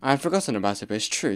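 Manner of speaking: ordinary talk, nothing marked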